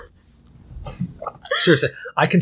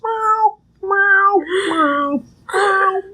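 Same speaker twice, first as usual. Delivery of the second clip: very high wandering meow; imitating a cat meowing aimlessly; much slower than usual. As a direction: sing out a lost little meow. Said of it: "like an aimless puppy going".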